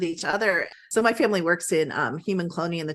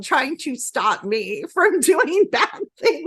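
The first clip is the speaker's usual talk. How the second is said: higher, louder and slow, with laughter breaking in; laughing while talking; 8 semitones higher, 4 dB louder, much slower than usual. Real, not acted. Telling the host about her daughter's dream, laughing. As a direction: laugh through the words, amused.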